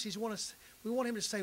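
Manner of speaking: ordinary talk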